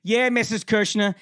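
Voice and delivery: high-pitched imitated teacher; Miss Mazacci. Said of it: "And Miss Mazacci goes".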